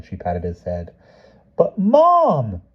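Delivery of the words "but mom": put-on high, loud child's wail. A narrator's reading. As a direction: wail like a boy nobody believes. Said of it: "he wailed".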